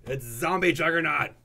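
ordinary delivery

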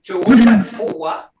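loud, slow Jogadoa read out; loud reading aloud. Shown as a demonstration. Teacher reading a sentence from the book, loud and slow. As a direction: call Jogadoa out slowly and loud. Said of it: "Right here it says".

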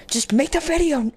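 high-pitched self-scolding; mock exasperation. Player scolding self over the timer, mock exasperation. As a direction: jump up high, playfully fed up.

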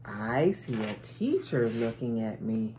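ordinary delivery, nothing marked

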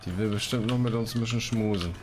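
ordinary talk

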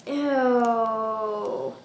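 normal talking voice

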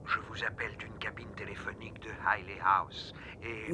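hushed whisper into phone. Acted. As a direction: whisper so nobody hears. Anonymous call to police.

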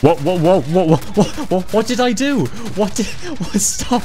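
very high, stammered whats; panicked bewilderment. Real, not acted. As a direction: squeal in confusion, half laughing. Bewildered by sudden trouble in game.

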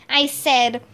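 usual speaking voice

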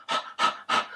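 a few quick, demonstrative puffs; out-of-breath panting. Shown as a demonstration. Teacher showing the effect of taking the stairs, brief breathless panting. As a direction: puff briefly as if winded. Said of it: "I'm out of breath".

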